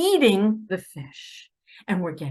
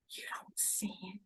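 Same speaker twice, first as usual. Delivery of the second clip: whisper; 20 dB quieter than usual. Real, not acted. Warning those rooting for sides.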